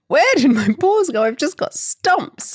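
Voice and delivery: high, loud bewildered dog voice; a dog waking up to find its paws gone; loud, high dismayed dog voice. Acted. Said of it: "the dog woke up and went".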